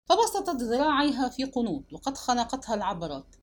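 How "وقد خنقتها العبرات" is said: ordinary delivery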